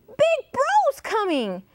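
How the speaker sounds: high-pitched chimp alarm; excited storybook chimp cry; whooping chimpanzee. A narrator's reading. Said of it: "whooped the chimpanzee".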